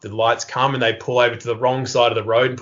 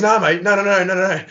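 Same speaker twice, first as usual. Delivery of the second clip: much higher, hurried-sounding panicky no; mock-flustered reassurance, pitched up; flustered denial; 7 semitones higher, 3 dB louder than usual. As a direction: imitate a cop backpedalling in alarm. Flustered cop denying, retold.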